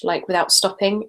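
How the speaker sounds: ordinary talk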